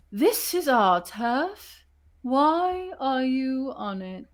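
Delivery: put-on very slow, drawn-out old owl voice; oldest owl. Old owl confronting intruders on her turf, slow creature voice. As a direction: challenge them slowly, stretching each word. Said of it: "who you all know is the oldest".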